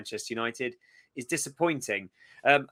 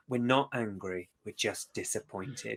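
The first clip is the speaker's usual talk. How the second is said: disappointed parent; 3 semitones lower, 8 dB quieter than usual. Retold or imitated. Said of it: "it's like when your parents are, like, saying".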